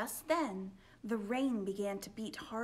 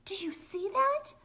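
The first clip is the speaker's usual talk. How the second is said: high loud frightened whisper; spooked girl in a shadowy room; scared whisper; 10 semitones higher, 7 dB louder than usual. Acted. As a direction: breathe it out in fright. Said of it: "she said".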